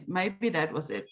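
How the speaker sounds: ordinary talk, nothing marked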